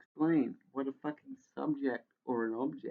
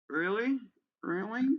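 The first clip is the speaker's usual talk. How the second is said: incredulous; 8 semitones higher than usual. Real, not acted. Incredulous at numbers as pictographs.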